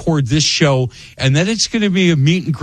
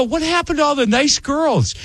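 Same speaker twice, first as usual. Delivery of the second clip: high-pitched, playful protest; mock dismay; 11 semitones higher than usual. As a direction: retell a bewildered complaint, pitched way up. Retold protest to the team.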